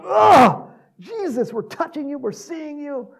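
loud gasp, then very high-pitched wonder; overjoyed amazement. Acted. Preacher as disciples touching the risen Jesus, amazed.

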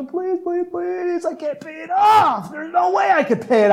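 very high, frantic begging voice; pleading servant. Acted. Pastor acts the indebted servant begging the master, high frantic pleading. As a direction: beg desperately, voice cracking upward. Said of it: "he started pleading with the master".

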